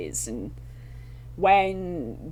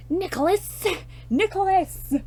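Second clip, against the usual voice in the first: frantic calling; 13 semitones higher than usual. Retold or imitated. Mother searching the house calls for her son, frantic high calls. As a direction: yell the name up high in a panic.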